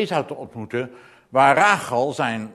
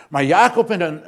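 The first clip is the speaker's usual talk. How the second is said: usual speaking voice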